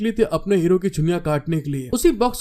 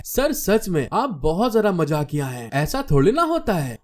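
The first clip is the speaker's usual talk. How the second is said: ordinary delivery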